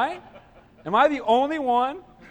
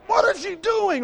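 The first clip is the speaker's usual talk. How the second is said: angry customer yell; 10 semitones higher than usual. Retold or imitated. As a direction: bark it like an impatient shopper. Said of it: "This guy's like".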